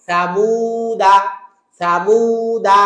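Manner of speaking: সামুদা drawn out very slowly, short final দা; very slow, careful সামুদা; very slow recitation. Shown as a demonstration. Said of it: "কি বলবো?".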